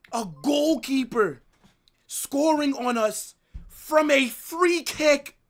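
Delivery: slow, high, loud outraged yell at the goalkeeper's goal. Real, not acted. Fan yelling at his club's defending, slow and furious. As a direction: drag out each word and shout it in disbelief.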